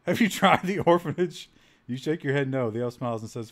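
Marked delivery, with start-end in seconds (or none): 0.1-1.5 loud, higher, laughing read; can't hold the laugh in. Real, not acted. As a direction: burst out laughing while reading it. Laughs at game suggestion.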